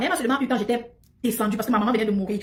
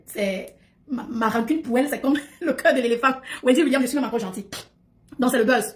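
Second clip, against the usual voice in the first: ordinary delivery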